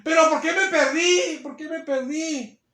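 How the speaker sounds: high, drawn-out mock lament, falling off at the end; lamento de condenados: lost sinners' lament. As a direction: wail it mildly as the lost asking why. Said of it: "Y dice la gente". (lamento agudo y alargado de los perdidos)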